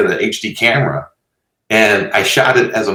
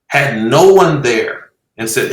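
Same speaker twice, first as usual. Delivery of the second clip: ordinary talk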